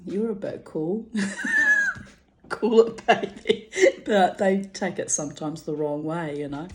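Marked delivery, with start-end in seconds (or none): 0.1-3.7 cheeky laughing tease; flirty teasing. Retold or imitated. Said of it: "like, saying".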